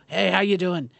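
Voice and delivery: offhand greeting in a natural voice; casual rodeo clown. Acted. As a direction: say hello like a working rodeo hand, no clown voice. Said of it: "if you talked to a rodeo clown".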